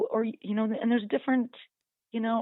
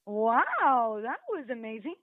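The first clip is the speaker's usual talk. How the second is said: delighted dog voice; 3 semitones higher, slower than usual. Retold or imitated. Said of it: "the dog goes".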